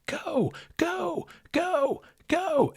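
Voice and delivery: high, far-off terrified yell of go go; shrieking to the driver in terror; panicked screaming. Retold or imitated. In the truck bed, begging the driver to go, high panicked screams. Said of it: "I began screaming".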